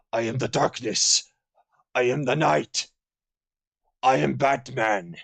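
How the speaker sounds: a lightly voiced mock superhero growl; a hint of brooding hero, each line landing down; hammed-up caped crusader lines, barely changed from his own voice; Batman impression. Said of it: "do an Italian Batman".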